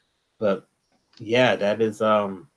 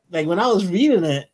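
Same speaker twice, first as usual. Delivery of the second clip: ordinary talk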